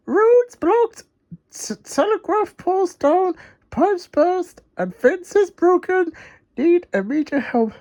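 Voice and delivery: high, urgent Wendy voice reading aloud; Wendy reading urgently. Acted. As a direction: read the fax in a rush, high. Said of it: "reading the fax out loud".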